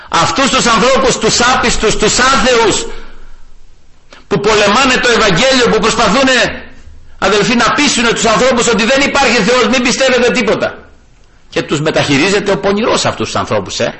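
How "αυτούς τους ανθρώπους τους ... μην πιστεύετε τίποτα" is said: raised, high fervent denunciation; fiery preaching, pitched high; preaching at full force. Acted. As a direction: rail against the unbelievers from the pulpit. Preacher rises against unbelievers.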